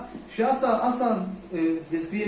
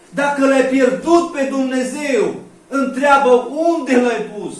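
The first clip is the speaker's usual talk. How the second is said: loud, slow sermon cry; forceful preaching; fervent preaching; 7 semitones higher, 11 dB louder, slower than usual. Acted. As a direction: preach it out, pleading.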